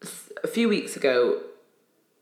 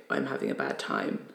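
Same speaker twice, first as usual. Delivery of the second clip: low quiet admission; 7 dB quieter than usual. Retold or imitated. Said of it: "get better at saying".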